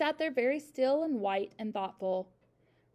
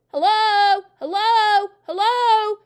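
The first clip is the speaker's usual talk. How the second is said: high, very loud repeated calls; loud, clear parrot; 10 semitones higher, 15 dB louder, much slower than usual. A narrator's reading. Hidden parrot calling from the dining room, loud and clear. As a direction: ring out across the hall. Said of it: "came the voice again".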